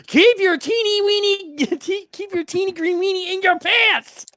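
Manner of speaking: high-pitched, hammed-up scolding; indignant character. Character refusing the piss bucket request, high indignant scolding. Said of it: "I can imagine he's like".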